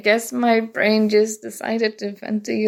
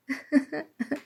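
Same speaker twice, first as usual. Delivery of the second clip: short nervous laugh; a small embarrassed giggle; awkward laugh; 5 semitones higher, 8 dB quieter than usual. Acted. Awkward pause meeting new roommate.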